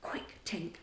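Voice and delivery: soft urgent whisper. A narrator's reading. Peter sneaking in, whispering urgently to Tink. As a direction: hush it so no one wakes. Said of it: "Peter whispered".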